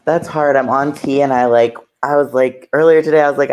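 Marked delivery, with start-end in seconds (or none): none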